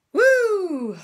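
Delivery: high, loud woo; excited whoop. Real, not acted. Shaking off the critic with a loud high whoop. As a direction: let it all out with a cheer. Celebrating after reading the critique.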